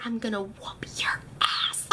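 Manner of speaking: hushed whisper, slow and menacing; whispered threat. Retold or imitated. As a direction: whisper it so the operator can't hear. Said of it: "She's like".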